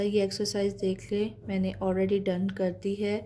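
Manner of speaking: usual speaking voice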